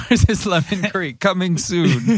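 giggling joke pitch; laughing. Acted. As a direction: laugh through the punchline.

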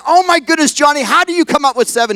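high, acted exasperation; exasperated teacher. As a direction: at wits' end with a pupil. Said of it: "about pulled her hair out".

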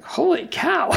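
high-pitched holy cow of disbelief; amazed. Retold or imitated. Skater staring at his near-perfect figure, amazed. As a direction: gasp it out, amazed at your own work.

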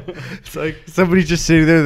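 usual speaking voice

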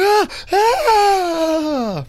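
high, loud yelps with a laugh in them; screaming. Retold or imitated. Swinging toward the bridge, high laughing screams. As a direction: scream in half-laughing panic. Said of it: "the way you were screaming".

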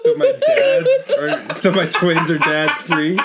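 high, slow, laughing through it; squeezing words out between laughs. Real, not acted.

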